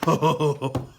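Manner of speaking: short laugh of relief; relieved laugh. Acted. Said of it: "laughing with relief".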